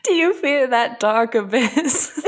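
slow, laughing pirate growl of Davy Jones; hammed-up sea-captain menace, slowed right down; Davy Jones accent. Friend quoting the Pirates villain, slow mock accent. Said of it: "What is this accent you're doing".

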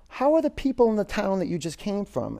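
a lightly voiced wise man, curious; wise old man.

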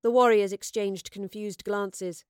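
plain reading voice

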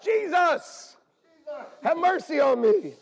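high, loud pleading call; blind man crying out. Acted. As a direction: beg for mercy across a crowd.

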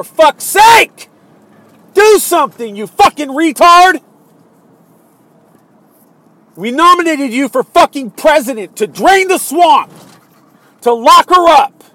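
loud, high-pitched tirade; furious shouting. Real, not acted. Angry voter yelling at the president to act, furious shout. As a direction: yell it in real exasperation. Furious rant addressed to Trump.

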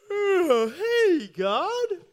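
high, loud, sleepy kitten waking up. Acted. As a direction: squeak up like a drowsy kitten. Sleepy kitten greeting God.

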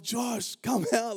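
high-pitched mock calling out; retold yelling. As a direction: imitate shouting for a missing brother. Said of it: "So I start yelling".

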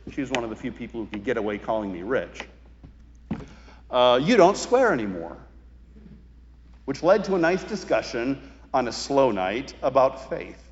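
ordinary delivery

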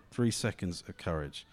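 hushed repeat of Three seconds of courage. Real, not acted. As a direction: let Three seconds of courage sink in softly. Repeat, dropped quieter.

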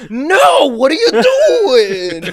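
high, hammed-up scream with a drawn-out ending; freaking out. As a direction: imitate a crewman screaming in horror. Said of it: "this dude freaks out".

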